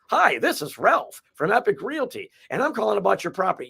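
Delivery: high, singsong canned sales greeting; totally scripted. Shown as a demonstration. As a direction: recite it like an over-rehearsed telemarketer. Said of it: "I don't want it to sound like".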